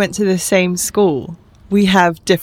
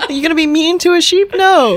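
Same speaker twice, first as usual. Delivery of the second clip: high, quick, amused rhetorical question; playful mock disbelief; 10 semitones higher, 3 dB louder than usual. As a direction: tease with a high-pitched, emphatic answer. Joking mock question and answer.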